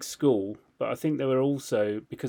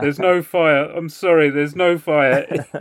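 loud, raised mock plea; retold embarrassed protest; 3 semitones higher, 10 dB louder, slower than usual. Culprit telling everyone outside it's a false alarm, loud mock plea. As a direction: call out apologetically, slowing on the repeat. Retold pleading to evacuating crowd.

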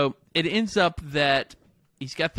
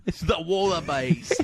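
mock Australian accent. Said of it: "you have a boomerang in your".

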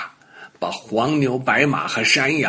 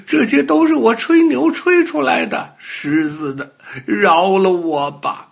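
high, loud crying little-dog voice; tearful pleading; 9 semitones higher, 4 dB louder than usual. A narrator's reading. Little dog begs the lion for mercy, crying. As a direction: beg through tears, pitched way up. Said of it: "小花狗一边哭一边说".

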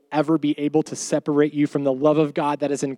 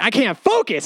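higher, whiny mock frustration; a higher exasperated "I can't focus."; exasperated complaint; 7 semitones higher than usual. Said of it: "I was like".

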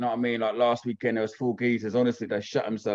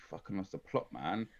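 much softer inner thought; muttered private thought; 2 semitones lower, 11 dB quieter than usual. Retold or imitated. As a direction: say it under your breath. Thinking to himself.

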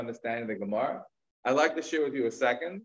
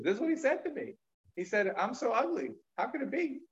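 higher, wounded retelling of the insult; aggrieved complaint; 9 semitones higher than usual. Retold or imitated. Ugly man tells townspeople about the insult, aggrieved and higher. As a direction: play the hurt victim.

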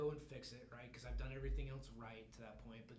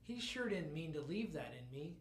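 normal talking voice

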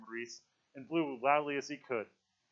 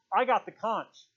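high, raised-voice objection; loud protest; 9 semitones higher, 9 dB louder than usual. A narrator's reading. A quarrel over who holds the conch, loud protest. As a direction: insist loudly on your turn.

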